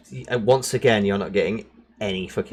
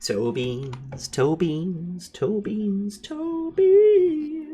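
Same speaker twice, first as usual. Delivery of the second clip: each toe beans higher, ending in a squeak; rising silly voice; 9 semitones higher, much slower than usual. Pretend. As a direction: climb higher on every repeat. Cute repeated phrase, rising.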